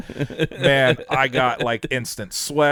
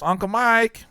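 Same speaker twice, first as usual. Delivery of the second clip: put-on child calling out; 9 semitones higher than usual. Kid calling from back seat.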